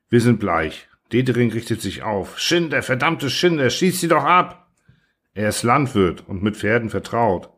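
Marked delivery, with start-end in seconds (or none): 2.4-4.7 high, strained cry of rage and pity; anguished angry outcry. Acted. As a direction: cry out in anguish at the men who leave the horses suffering. Farmer erupts at horses' suffering. (verzweifelter, wütender Aufschrei)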